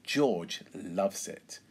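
usual speaking voice

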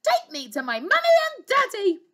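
high, bossy princess shout; loud, demanding child's voice; bellowed order. Acted. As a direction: order the dragon about loudly. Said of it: "bellowed the little princess".

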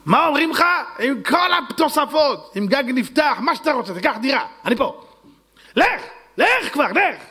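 high, excited urging voice; eager rich old man. Acted. Rich man hurrying the buyer off, high and eager.

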